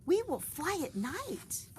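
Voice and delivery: high slow eager promise; high bright vow; excited. Acted. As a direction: savor the plan. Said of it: "said excitedly".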